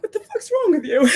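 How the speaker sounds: high voice between crying and laughing; tearful laughing. Real, not acted. Asking herself what's wrong, tearfully laughing. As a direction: laugh at yourself through real tears. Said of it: "I'm, like, constantly crying".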